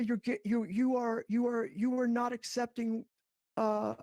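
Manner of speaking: usual speaking voice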